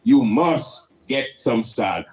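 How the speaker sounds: ordinary delivery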